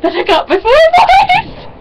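hammed-up joyful shriek; excited reveal. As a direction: build to a high celebratory squeal. Retold celebrating news with mom.

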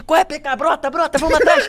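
high, urgent imitated call; delivery rider calling out. IFood rider urging him to join the chase, high mimicked call. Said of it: "Aí o maluco do iFood".

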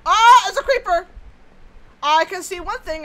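high, very loud fright; startled alarm. Real, not acted. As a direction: shriek at the sudden creeper. Creeper appears in dark.

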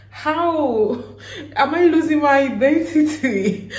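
high, laughing disbelief; mock dismay. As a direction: play up the identity crisis with a laugh. Acting out dismay at friends' remark.

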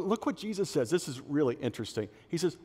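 usual speaking voice

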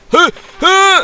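high, loud "eh!" yelps; short high-pitched shouts; startled yelp. Real, not acted. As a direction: yelp sharply in mid-game alarm. Startled yells while playing the game.

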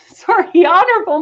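giggle, apologise, start the title over; laughing at a slip. Real, not acted. Host tripping over the minister's title, laughing it off. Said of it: "I'm trying to say too many words".

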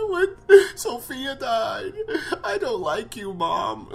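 high, mock-tearful child actor; Carl, badly acted crying. Boy mourns his mother, badly faked high crying. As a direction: whine it out in a hammed-up fake cry. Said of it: "It's terrible acting".

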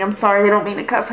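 usual speaking voice

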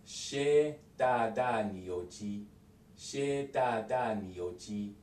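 "xie da da ni you ji" very slowly, syllable by syllable; drawn-out careful "xie da da ni you ji"; slow full form. Shown as a demonstration. Teacher gives the full question slowly before the fast one. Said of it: "when they say it fast".

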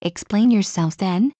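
ordinary delivery, nothing marked

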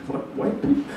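breathy whisper under the laughter; whispered aside. Real, not acted. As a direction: sneak it in as a hushed aside. Muttered aside after the bit.

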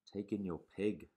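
put-on slow, measured old sage; wise old sage. A narrator's reading. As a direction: advise calmly and slowly. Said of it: "said the wise old man".

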